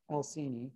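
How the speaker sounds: "Alcini" with the last E voiced as "ee"; sounded final E. Shown as a demonstration. Lecturer repeating the correct plant name, sounded final E. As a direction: close "Alcini" on an audible ee, matter-of-fact. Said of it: "Terminal E's are always pronounced".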